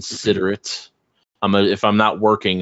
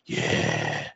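low, breathy mock Batman voice, much lower and softer; a little breathy; 6 dB quieter than usual. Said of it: "get a little breathy".

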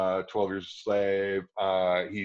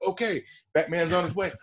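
mock heroic; 9 semitones higher than usual.

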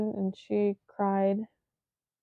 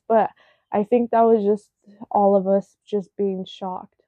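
ordinary talk

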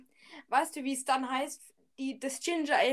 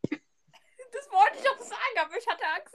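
laughing; 9 semitones higher than usual. Real, not acted. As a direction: squeeze the words out while laughing. Laughing at the joke.

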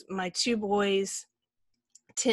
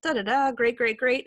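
mock cheery; 4 semitones higher, 8 dB louder than usual. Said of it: "I was like".

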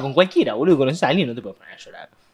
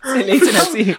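laughing; 8 semitones higher, 3 dB louder than usual. Real, not acted. Laughing over the nickname.

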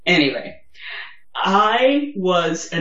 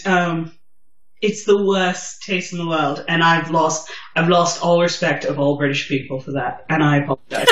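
ordinary delivery

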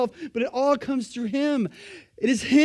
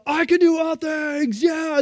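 mock rally shout; 6 semitones higher, 3 dB louder, slower than usual. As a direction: shout the slogan like a rally chant, slow. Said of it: "you're like".

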